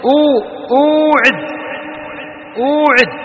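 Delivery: "أوعد" with a long drawn-out "uu"; واو ساكنة ممدودة. Shown as a demonstration. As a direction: stretch the first syllable of "أوعد": uuu-ʕid. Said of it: "الواو ساكن". («أوعد» بمد الواو الساكنة)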